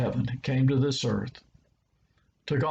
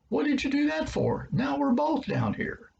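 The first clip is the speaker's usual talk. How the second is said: incredulous; 14 semitones higher than usual. Acted. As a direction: sound baffled someone jumped in with you. Man in hole reacts, incredulous.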